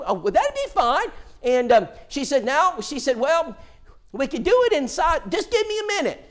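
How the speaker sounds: lightly voiced country woman; [name]'s voice.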